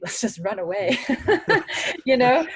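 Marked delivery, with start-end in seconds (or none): 0.0-1.0 laughing through the words; half-laughing as she says it. Retold or imitated. Joking about running away from dad. 1.0-2.0 a real burst of laughter, high and loud. Real, not acted. Laughing at her own suggestion.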